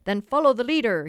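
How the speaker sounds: put-on rally the boys with glee. A narrator's reading. Father gaily calls the boys to follow him. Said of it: "he cried gaily".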